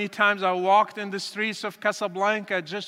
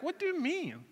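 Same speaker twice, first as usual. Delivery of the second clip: higher, softer startled question; retold shock; 6 semitones higher, 11 dB quieter than usual. Father thrown by his son's question, mildly shocked. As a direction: imitate a father taken aback, voice up but hushed. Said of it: "It kinda shocked him".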